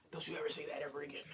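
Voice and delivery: hissed warning under the breath; a whispered don't you ever. Retold or imitated. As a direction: breathe it out as a stern threat. Telling mom off in a whisper.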